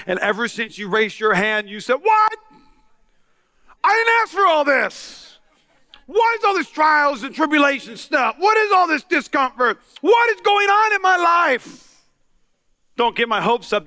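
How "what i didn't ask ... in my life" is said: indignant shout. Acted. New believer complaining to God.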